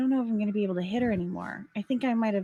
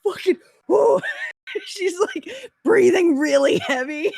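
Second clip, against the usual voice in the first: excited laughing; 10 semitones higher, 5 dB louder than usual. Real, not acted. Player excitedly describing raging enemy.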